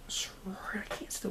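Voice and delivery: muttered whisper while stumbling over a word. Real, not acted. Vlogger tripping over the word sorority, whispering to himself. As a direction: whisper it under your breath, fumbling.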